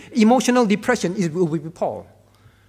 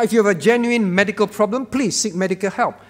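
ordinary talk

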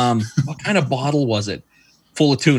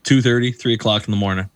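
normal talking voice

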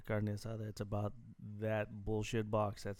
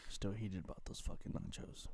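muttered grumble; 8 dB quieter than usual. Real, not acted. Friend sulking to his co-host about nachos, muttered. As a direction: grumble it under your breath.

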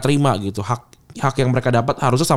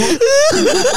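high burst of laughter. Real, not acted. Laughing at blocked count.

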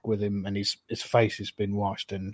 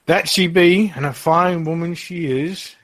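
innkeeper voice; 7 semitones higher, 5 dB louder than usual. Acted. Innkeeper voice answering player.

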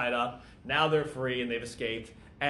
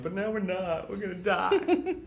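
mock panic; 5 semitones higher than usual. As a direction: wail it half-laughing, pretending doom. Voices panicking character in retold scene.

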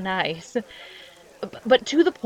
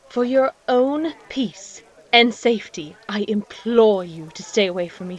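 ordinary talk

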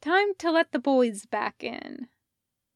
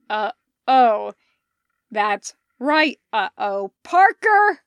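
angry bellow; 9 dB louder, slower than usual. Acted. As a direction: call her out in a fury. Elliot bellows for Parker.

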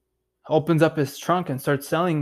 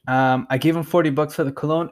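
ordinary talk, nothing marked

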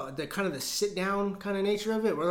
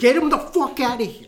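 higher, louder hammed-up gangster order; mock mobster yell; 5 semitones higher, 6 dB louder than usual. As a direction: repeat it as an exasperated mob-boss shout. Mobster act, repeated louder.

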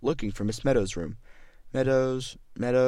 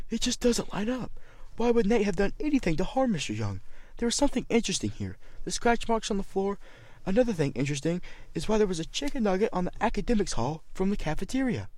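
Sparky voice; 10 semitones higher than usual. Acted. Sparky reasons through the clues.

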